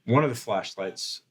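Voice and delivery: normal talking voice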